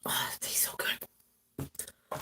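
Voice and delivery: very soft, slow whisper; hushed aside. Real, not acted. Host savoring a taste mid-stream, whispered aside. Aside while tasting something.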